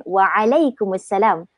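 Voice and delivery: Waalaikumsalam slow, pressing the ain in "alai"; emphasised ain. Shown as a demonstration. Teacher showing how Arabs emphasise it, Waalaikumsalam with pressed ain. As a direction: lean on the throat sound in Waalaikumsalam. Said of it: "Dia akan tekankan".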